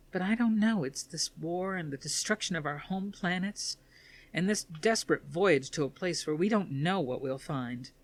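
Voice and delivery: a daughter spilling out her despair to her father; distraught daughter. Acted. Athena pours out her distress.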